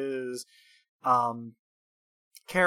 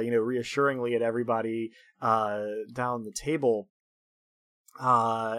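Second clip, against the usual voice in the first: usual speaking voice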